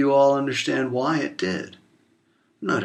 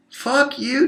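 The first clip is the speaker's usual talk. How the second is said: angry outburst; 13 semitones higher than usual. Real, not acted.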